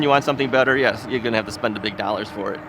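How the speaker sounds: usual speaking voice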